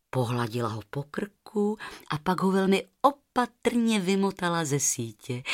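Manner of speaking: ordinary delivery, nothing marked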